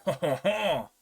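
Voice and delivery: imitating his laugh as ha ha ha; a light put-on ha ha ha; a hint of the Frenchman's chuckle; mock laugh. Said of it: "'cause he went".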